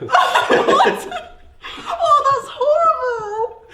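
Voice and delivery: high, loud outraged squeal through laughter; squealing with laughter at a grim image; shocked laughing shriek. Real, not acted. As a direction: react in laughing horror. Shocked at whale origami joke.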